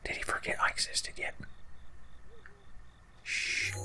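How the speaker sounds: very soft unvoiced whisper. Real, not acted. Hiding from the enemy behind a tree, whisper. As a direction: hush it while hiding.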